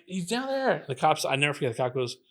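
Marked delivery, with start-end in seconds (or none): 0.1-0.7 urgent pointing-out. Retold or imitated. Urgently pointing cops to the shooter.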